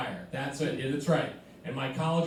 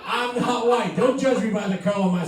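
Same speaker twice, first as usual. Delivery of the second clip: mock indignant; 7 semitones higher, 6 dB louder than usual. Mock indignant denial, laughing.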